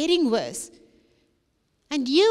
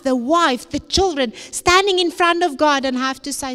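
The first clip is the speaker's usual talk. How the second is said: rising, louder preacher's warning, pressing each never; preaching at full force; 5 dB louder than usual. Acted. Preacher warning the youth of Satan's plan, full force preaching. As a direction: hammer the lost purpose home from the pulpit.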